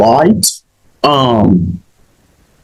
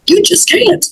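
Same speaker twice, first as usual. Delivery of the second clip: exasperated verdict. Real, not acted.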